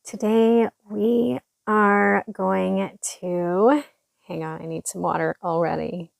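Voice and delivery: ordinary talk, nothing marked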